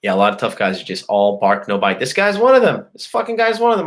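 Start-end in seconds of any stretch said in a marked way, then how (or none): 2.0-2.8 high, louder heated jab. Real, not acted. Ranting about the stepdad. 2.9-3.8 high heated repeat. Real, not acted. Ranting about the stepdad.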